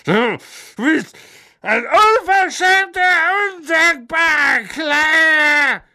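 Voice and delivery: high, loud, slow raging shout; furious shout. A narrator's reading. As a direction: scream at him in rage. Marge screams on.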